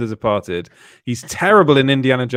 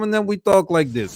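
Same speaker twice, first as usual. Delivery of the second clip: higher, put-on Russian accent; mock Russian accent; 4 semitones higher than usual. Co-host mocking the film's sudden switch, exaggerated Russian accent. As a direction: send up a film Russian accent. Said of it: "there's the Russian come".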